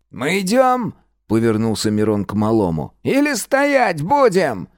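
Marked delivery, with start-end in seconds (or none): 0.1-0.9 high curt question; impatient. A narrator's reading. Miron impatient after fight. 3.0-4.7 high, slow cracking falsetto; voice breaking into falsetto. A narrator's reading. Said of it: "Его голос против воли сорвался на фальцет".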